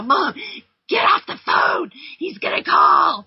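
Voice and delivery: high, loud desperate shout; frantic teenage yell, a little hammed-up; teen yelling at mom. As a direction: yell at mom to free the phone line. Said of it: "maybe I didn't really say it like that".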